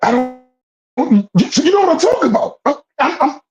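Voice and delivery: can't believe how ripped he was; incredulous excitement. Real, not acted. To the friend, about the swole homeless man: high incredulous rant.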